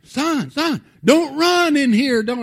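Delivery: shouting across the sanctuary to stop a son; calling out to stop. Retold or imitated. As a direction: yell after a running child. Calling after running child.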